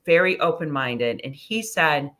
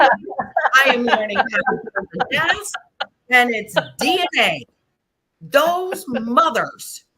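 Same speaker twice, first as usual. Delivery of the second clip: much higher and louder, replaying her fury; retold outraged rant; 7 semitones higher, 3 dB louder than usual. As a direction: rant it like she did back then, pitch way up. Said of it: "I remember getting pissed".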